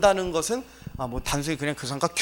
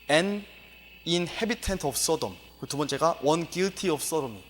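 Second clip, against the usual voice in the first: slow dictionary reading; much slower than usual. Shown as a demonstration. Reads dictionary definition slowly.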